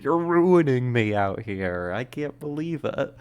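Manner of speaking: ordinary delivery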